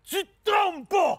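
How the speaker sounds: high, drawn-out shout. Acted. Man repeating directions to his co-host, shouting. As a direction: shout it slowly for someone who can't hear.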